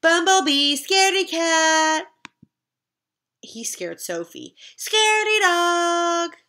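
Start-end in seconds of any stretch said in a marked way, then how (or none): 0.0-2.1 taunting. Acted. Bert taunts. 4.8-6.3 loud, high, drawn-out taunt. Acted. Bert taunts dog.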